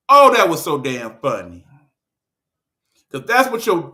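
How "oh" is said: ordinary talk, nothing marked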